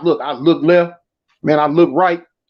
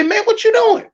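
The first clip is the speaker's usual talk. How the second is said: high, outraged "what you doing"; jumping up furious at the officer; indignant outburst; 13 semitones higher than usual. Retold or imitated. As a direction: snap at the cop in disbelief. Angry after stick in mouth.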